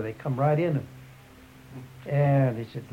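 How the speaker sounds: usual speaking voice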